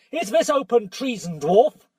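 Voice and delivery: put-on young king accusing the dwarf; stern challenge. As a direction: confront the traitor. Caspian challenges Nickabrick.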